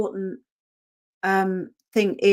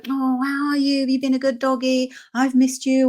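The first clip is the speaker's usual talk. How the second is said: put-on high, gushing baby-talk to the dog; doting owner greeting; 5 semitones higher than usual. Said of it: "we all normally go".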